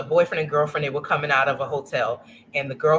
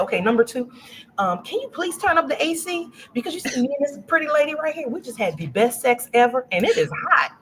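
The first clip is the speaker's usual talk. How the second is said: imitating a Southern country man, pitched much higher; country accent; 9 semitones higher than usual. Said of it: "he has the most country's accent".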